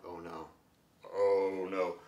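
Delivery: low, hushed foreboding; softly dismayed, dropped pitch; quiet mock dread. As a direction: murmur it low, as if sensing disaster coming. Reader's dread at foreshadowed disaster.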